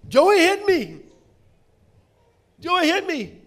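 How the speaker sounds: put-on little boy calling for his parents, building to a high, loud wail; child yelling. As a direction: start pleading, then shriek it like a hurt kid. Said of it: "and he's yelling".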